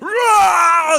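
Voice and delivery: high, very loud roar; animal rage roar. Acted. Driver losing it at the wheel, animal roar. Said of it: "he makes this animal noise".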